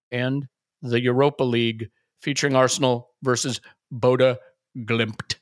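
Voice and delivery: deep trailer-voice sign-off; a touch of deep announcer closing the ad; low announcer tag. Acted. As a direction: drop the voice low for the tagline. Announcer drops low for tagline.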